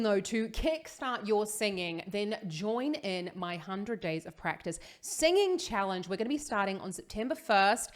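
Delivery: normal talking voice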